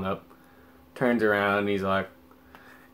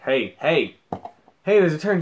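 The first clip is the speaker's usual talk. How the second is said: higher, louder, insistent heads-up; repeated heys building to a raised warning; urgent warning; 6 semitones higher, 6 dB louder than usual. Retold or imitated. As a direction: call out to a driver about to miss the turn. Repeated warning to driver, escalating.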